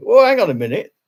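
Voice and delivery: put-on a playful take on a pupil catching their old teacher out; mock startled ex-pupil. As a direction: play a former student suddenly recognising you. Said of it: "People will come back and say".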